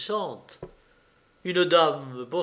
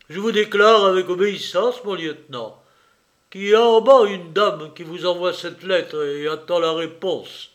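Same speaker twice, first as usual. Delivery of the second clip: loud, raised orderly's voice; Schweik reporting; 3 semitones higher, 7 dB louder than usual. Acted. Orderly announces a lady's letter to his lieutenant, loud reporting voice. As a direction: report loudly to your officer.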